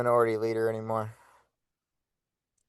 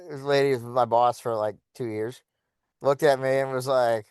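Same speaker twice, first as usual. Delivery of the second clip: ordinary delivery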